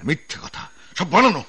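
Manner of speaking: outraged, rising to a high loud cry; angry denial. Acted. Officer cries out denying the bribe, outraged. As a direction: fling the accusation back at them.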